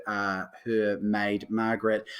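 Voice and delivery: normal talking voice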